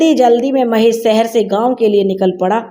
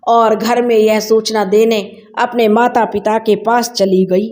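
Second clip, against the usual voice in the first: usual speaking voice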